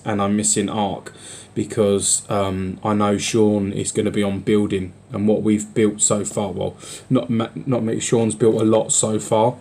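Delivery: usual speaking voice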